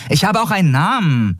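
high-pitched, indignant retort. A narrator's reading. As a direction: bristle in a high companion voice. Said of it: "erinnerte Nelen ihn aufgebracht".

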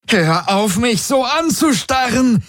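very high-pitched, frantic cry; scream. A narrator's reading. Ylana to the old woman staring at her, screaming. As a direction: cry out high and desperate, without much volume. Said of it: "schrie Ylana".

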